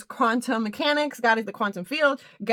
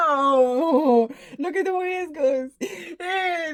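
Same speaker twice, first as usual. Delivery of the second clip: laughing; 5 semitones higher, much slower than usual. Real, not acted. Laughing at seal photo.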